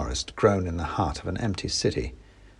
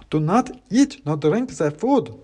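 clacking wooden soldier; 7 semitones higher, 3 dB louder than usual. Acted. Klaus warns the narrator not to eat, clacking. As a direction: warn in a stiff wooden clack. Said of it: "said Klaus".

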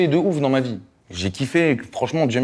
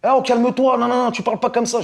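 high mocking cop voice, much higher; mocking policeman; 13 semitones higher than usual. Mimicking the officer who tells him to calm down, high and squeaky. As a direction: squeak the cop's scolding back at him.

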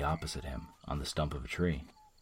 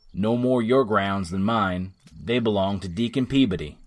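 sneer; 3 semitones higher, 7 dB louder than usual. A narrator's reading. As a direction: scoff at the claim. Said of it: "said Tom with a sneer".

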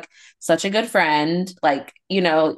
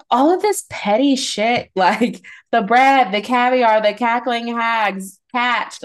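mocking disbelief; 6 semitones higher, slower than usual. Real, not acted. Mocking the petty reality drama.

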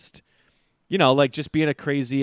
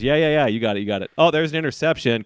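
dismissive then excited; 5 semitones higher, 6 dB louder, much faster than usual. Retold or imitated. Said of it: "He goes".

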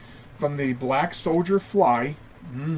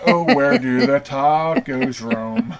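drawn-out mock sing-song lament; 5 semitones higher, 7 dB louder, slower than usual. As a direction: wail it slowly like an old ballad.

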